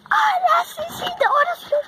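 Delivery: high excited shrieks; excited cheer. Real, not acted. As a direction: cheer like you finally won. It finally comes down.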